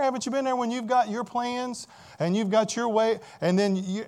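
ordinary delivery

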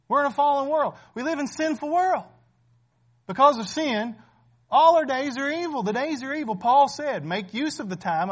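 loud, driving sermon voice, pressing each point home; preaching at full force. Acted. Pastor warning the congregation their days are few, preaching loud. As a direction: preach it hard to the pews.

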